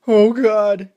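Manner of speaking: wailing despair; anguished cry. A narrator's reading. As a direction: cry out as he dies. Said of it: "he cried".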